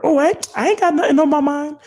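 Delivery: very high mock-female denial; coy woman. Woman denying she has anything in mind, high mock-coy voice. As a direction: squeak out an innocent denial as the date. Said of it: "she looking at you like".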